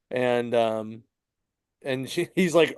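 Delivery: ordinary talk, nothing marked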